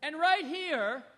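usual speaking voice

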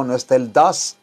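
ordinary talk

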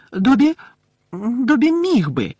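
high elf voice, faltering with a slow stretch; unsure little elf, drawn out; hesitant elf. A narrator's reading. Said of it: "невпевнено промовив він".

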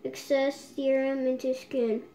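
mask over lips; speaking through sheet mask. Real, not acted. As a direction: damp cloth on face.

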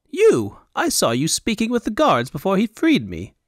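high, lilting foreign fop; high Elvunian accent. Acted. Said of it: "he said in a high Elvunian accent".

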